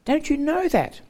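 high, scornful dismissal; mocking pagan. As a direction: scoff at Paul as a Corinthian would. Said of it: "they'd say".

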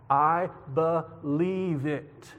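very slow, drawn-out words, mildly breathless; breathless insistence. A narrator's reading. Character insisting to the other that he believes, breathless. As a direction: stretch each word out, lightly acted conviction. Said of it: "Shepard said breathlessly".

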